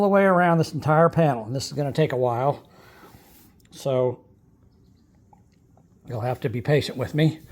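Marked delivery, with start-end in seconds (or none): none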